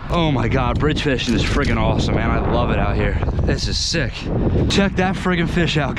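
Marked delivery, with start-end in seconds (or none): none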